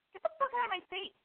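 high, angry blow-up; belligerent. Retold or imitated. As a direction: lose it at her. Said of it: "I get belligerent".

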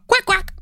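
very high mock quacking; duck quack imitation. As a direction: squeak it out like a cartoon duck.

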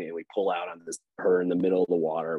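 ordinary delivery

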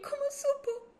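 very high, squeaky alarmed question; mock panic. Daughter asks mother how dad found out, panicked. Hija alarmada, retoma su reacción.

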